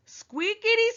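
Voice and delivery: squeakity in a very high squeak; squeaky high voice. Shown as a demonstration. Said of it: "Please listen for sound words".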